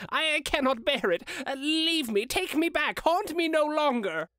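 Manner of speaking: put-on high, desperate begging old man; anguished plea. A narrator's reading. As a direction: plead in rising panic. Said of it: "Scrooge exclaimed".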